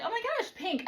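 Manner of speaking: sudden high squeal of delight; excited gasp. Retold or imitated. To viewers, retelling spotting the shirt: excited high squeal. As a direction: gush over the pink shirt. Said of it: "And I was like".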